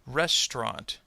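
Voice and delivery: "Restaurant." said as RES-tront; dropped middle syllable. Shown as a demonstration. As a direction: demonstrate "Restaurant." in two syllables. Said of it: "middle syllable will disappear".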